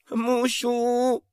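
high, drawn-out cries for mummy; crying out. Acted. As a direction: wail it high, stretching the vowels. High drawn-out cries within story.

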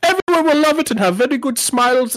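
very high, hammed-up mock German accent; German-sounding Swedish accent. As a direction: squeal it like a cartoon German-Swedish executive. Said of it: "I don't know why he's German".

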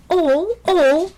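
high, loud indignant squeak; affronted squeaky mouse; protesting mouse. A narrator's reading. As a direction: protest it in a shrill little voice. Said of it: "protested Horatio".

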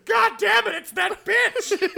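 very high, loud mock outrage; imitating co-host's enemy yell. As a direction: yell it like a hammed-up game enemy, laughing at the end. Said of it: "react the way you".

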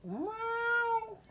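high squeaky meow; cat meow. Pretend. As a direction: meow like a kitten. Playful cat noise.

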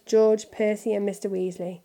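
ordinary delivery

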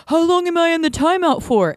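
put-on high, plaintive drunk voice; whiny drunk guy. Drunk stranger in timeout asks, whiny and high. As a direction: ask it like a sulky scolded child. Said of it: "he's saying".

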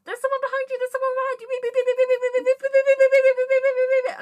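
very high, loud, frantic alarm voice; parking sensor imitation. Driver imitating her dented car's parking sensors, high frantic beeping. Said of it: "it was like".

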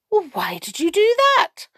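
high surprised question; astonished queen. A narrator's reading. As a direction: gasp it out in astonishment. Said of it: "asked the Queen in astonishment".

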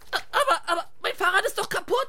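very high, half-laughing whine; whiny squeaky voice. Acted. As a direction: squeak out a weak excuse. Whiny excuse, voiced sidekick.

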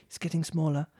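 ordinary delivery

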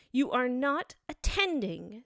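severe. A narrator's reading. Mouse scolding inattentive Alice, severely. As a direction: reprimand sternly, each word weighted. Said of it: "said the mouse to Alice severely".

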